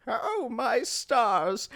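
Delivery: put-on high, soft, drawn-out old lady. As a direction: play a frail old lady softly exclaiming. Voices startled old lady in retelling.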